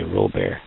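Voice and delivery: Robert said French-style, ro-BAIR, final t silent; Robert as ro-BAIR, stress on the end, no t; mock-French Robert, ro-BAIR; French-style name. Shown as a demonstration. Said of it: "throw the French in there and say".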